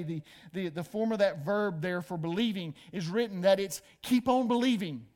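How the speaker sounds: ordinary talk, nothing marked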